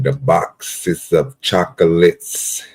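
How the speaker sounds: slow, stretched Southern drawl on every word; Forrest Gump drawl. Retold or imitated. Streamer showing off his Forrest Gump, slow Southern drawl. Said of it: "That's my Forrest Gump".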